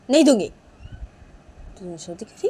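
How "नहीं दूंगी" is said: loud, defiant, raised-pitch no; angry refusal. Acted. Manisha refusing Nisha the baby, loudly and angrily. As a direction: refuse point-blank in a big voice.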